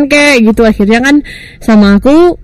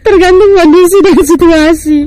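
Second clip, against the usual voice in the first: laughing; 8 semitones higher than usual. Real, not acted. Joking about changing ideal partners.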